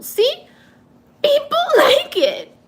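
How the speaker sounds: high, loud, laughing squeal; real giggling till crying. Real, not acted. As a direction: squeak it out through teary laughter. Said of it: "I'm laugh crying".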